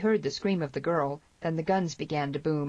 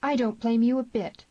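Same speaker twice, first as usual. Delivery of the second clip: raised, higher outburst; exclaiming; 7 semitones higher, 5 dB louder than usual. A narrator's reading. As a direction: blurt it out with feeling. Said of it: "she exclaimed".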